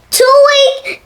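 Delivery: sharp high tweak sound; high squeak. A narrator's reading.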